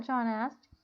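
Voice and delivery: ordinary delivery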